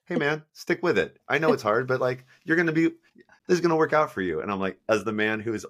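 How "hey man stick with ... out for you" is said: earnest encouraging coach. Retold or imitated. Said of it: "You were like".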